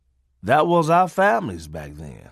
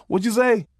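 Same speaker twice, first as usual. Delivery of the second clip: mock innocent question; 9 semitones higher than usual. Teasing grandfather.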